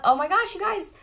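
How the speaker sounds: high, bright burst of delight; excited squeal. Real, not acted. Host thrilled by her live viewers, excited squeal. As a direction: gush at the viewers with real excitement.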